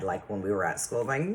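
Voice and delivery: normal talking voice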